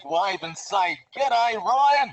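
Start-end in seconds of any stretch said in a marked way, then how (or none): 1.1-2.1 a cheery, broad Australian "G'day, Ryan." sung out with a falling end, higher and louder; Australian accent greeting. Shown as a demonstration. Diver shows viewers how to greet his buddy, in a broad Australian accent. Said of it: "practice your best Australian accent".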